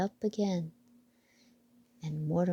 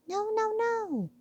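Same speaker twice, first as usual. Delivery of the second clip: very high mouse squeak. Acted. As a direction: squeak in little-mouse dismay. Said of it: "squeaked Mortimer".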